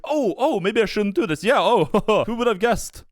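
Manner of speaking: hammed-up fake innocence, breaking into a laugh; mocking dopey realization. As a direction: play him as a clueless oaf. Mocking the man backing off.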